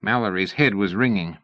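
ordinary delivery

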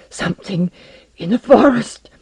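high, loud alarm in broken bursts; panting alarm. A narrator's reading. Scientist runs into base from the forest, panting alarm. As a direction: gasp out a warning after running. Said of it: "he panted".

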